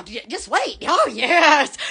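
usual speaking voice

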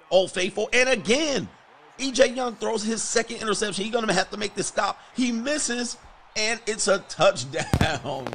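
high, loud disbelieving outburst as the play unravels; excited shout. Real, not acted. Gamer's play goes wrong live.

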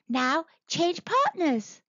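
high monkey calling voice; clever monkey. A narrator's reading. Monkey directing the monster's dance, calling high. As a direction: call the dance change high.

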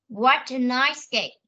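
teacher modelling each word slowly; slow model reading. Shown as a demonstration. Teacher showing the class how to read, slow and clear. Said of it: "Con sẽ đọc lại cho cô".

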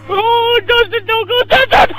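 high, loud pleading yell; desperate shout. Real, not acted. Player yelling after Dustin as he flies off, desperate shout. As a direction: call him back at the top of your voice.

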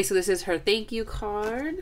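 usual speaking voice

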